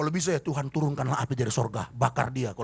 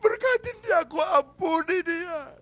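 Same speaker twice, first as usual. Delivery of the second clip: high, slow tearful plea to God; crying prayer; 11 semitones higher, slower than usual. Acted. Preacher acting a believer praying while crying, tearful and slow. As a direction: forgive through mock sobs, drawn out. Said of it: "sambil nangis dari Tuhan".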